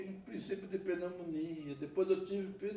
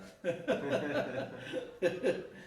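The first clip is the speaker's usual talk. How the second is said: a real laugh, trailing down; warm laugh. Real, not acted. Preacher laughing after the Billy Graham story. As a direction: laugh along with the congregation.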